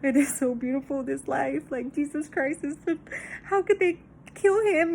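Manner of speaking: hammed-up weepy awe; imitated crying. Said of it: "other times where I'm fucking crying".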